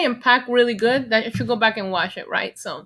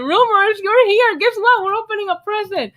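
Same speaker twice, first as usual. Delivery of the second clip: higher and louder, thrilled; excited greeting; 9 semitones higher, 4 dB louder than usual. Real, not acted. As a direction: call out gleefully to a chatter.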